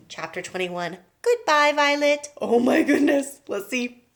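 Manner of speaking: usual speaking voice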